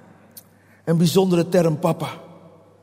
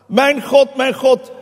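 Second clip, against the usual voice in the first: higher, louder anguished call to God; crying out; 6 semitones higher, 4 dB louder, slower than usual. Acted. Preacher voices Jesus on the cross, crying out. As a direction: call out in raised voice, restrained shout. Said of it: "schreeuwde hij".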